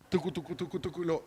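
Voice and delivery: very soft playful tucu tucu sounds; soft sucking noise. Retold or imitated. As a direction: mimic sucking pain away quietly. Imitating sucking out the pain. (imitación suave de succionar)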